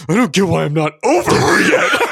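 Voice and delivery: loud, high wail imitating a friend; hammed-up breakup whining, stretched at the end; mock heartbroken wail. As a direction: tease him with an exaggerated lovesick cry. Mimicking friend upset over breakup.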